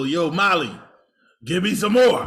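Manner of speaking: put-on loud, high drunken plea. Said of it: "He better go".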